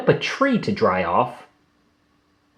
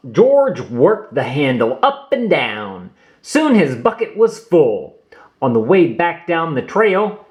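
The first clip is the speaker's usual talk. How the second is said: plain reading voice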